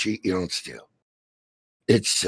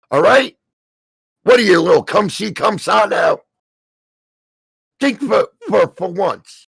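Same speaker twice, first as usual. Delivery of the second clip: angry; 5 semitones higher, 3 dB louder than usual. Real, not acted. Ranting at a debtor.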